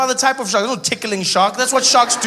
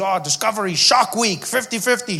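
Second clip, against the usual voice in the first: normal talking voice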